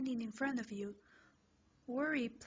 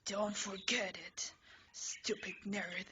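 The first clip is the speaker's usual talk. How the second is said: low whispered threat; 3 semitones lower than usual. Acted. Leaving after the fight.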